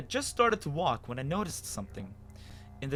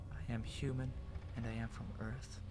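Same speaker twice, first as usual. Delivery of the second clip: hushed, much softer murmur; whispering; 3 semitones lower, 10 dB quieter than usual. Acted. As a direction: whisper it to yourself. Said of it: "I began whispering".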